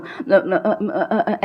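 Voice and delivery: halting fake stutter; mock stammer. Shown as a demonstration. Speaker imitating a nervous stage fumble, mock stammer. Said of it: "ऐसे करने लगूँ".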